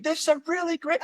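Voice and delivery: high-pitched, over-eager mock blurt; uncontrolled volume. Actor retelling his botched first audition, blurting with uncontrolled volume. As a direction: act out nerves with no volume control. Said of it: "couldn't control the volume".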